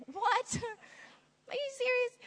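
high, incredulous squeak; mock disbelief. Preacher acting shocked at God's command, high. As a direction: gasp it like you can't believe it. Reacting to love your enemies.

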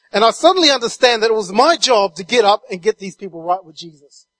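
usual speaking voice

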